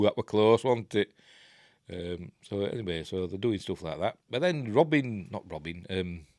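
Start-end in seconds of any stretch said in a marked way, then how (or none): none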